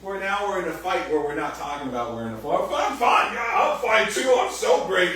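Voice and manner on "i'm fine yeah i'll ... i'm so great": shouted high, sarcastic fighting words; mock yell. Spouse yelling back mid-fight, sarcastic shout. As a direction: hurl it back, way up and loud. Acted passive aggressive spouse quarrel.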